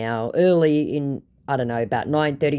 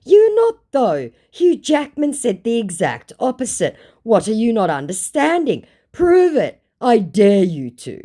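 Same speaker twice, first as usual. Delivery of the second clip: higher and louder, scolding the scammer; indignant challenge; 9 semitones higher, 6 dB louder than usual. Retold or imitated. Telling off a fake Hugh Jackman, indignant and loud. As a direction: fire it back with a dare. Angrily retelling her replies to scammer.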